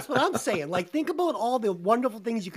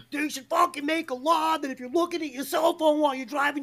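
put-on high-pitched old-guy griping; hammed-up grumbling complaint, pitched up; ranting old guy; 8 semitones higher than usual. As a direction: rant like a cranky backseat lawmaker. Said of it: "I'm the old guy".

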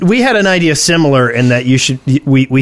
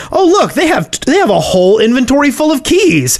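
mock accusing voice; 7 semitones higher than usual. Mocking griefers being caught.

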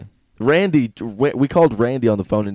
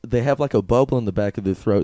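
usual speaking voice

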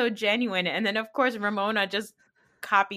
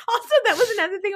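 higher, fast, talking through laughter; laughing; 12 semitones higher, 3 dB louder, much faster than usual. Real, not acted. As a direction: laugh while starting the next bit. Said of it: "still laughing".

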